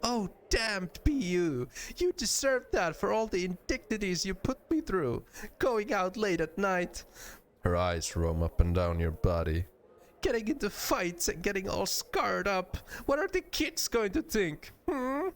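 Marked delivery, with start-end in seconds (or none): 0.0-7.0 high-pitched mock indignation; hammed-up nagging, cute rather than menacing; cute scolding. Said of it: "scrunches up her face in a cute scowl". 10.2-15.3 high-pitched nagging, rising higher at the end; hammed-up reproach in a high voice; mock wifely scolding. Pretend wife scolds husband.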